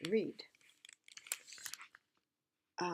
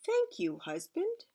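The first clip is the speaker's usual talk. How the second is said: queen's voice; 13 semitones higher than usual. Acted.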